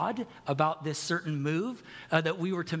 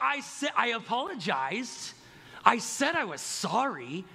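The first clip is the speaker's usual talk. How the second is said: high, drawn-out mock indignation; defensive non-apology; 6 semitones higher than usual. Spouse challenged later about an apology, defensive and exasperated. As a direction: insist on it slowly, pitched up like an exasperated spouse.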